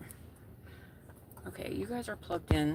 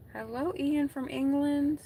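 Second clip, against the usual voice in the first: louder, higher, slow bright hello; excited greeting; 9 semitones higher, 5 dB louder than usual. Real, not acted. Streamer greeting a viewer from England, sung out loud. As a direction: call out the greeting to a new viewer.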